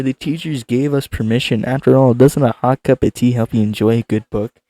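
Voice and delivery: usual speaking voice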